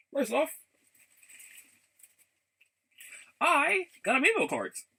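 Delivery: high, loud excited announcement; excited reveal. Real, not acted. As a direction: burst out with the news of the cards. Showing off new amiibo cards.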